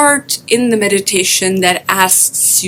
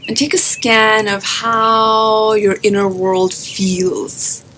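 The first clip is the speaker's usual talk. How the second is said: guided meditation. Acted. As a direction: guide listeners gently into their breath, drawing out phrase endings.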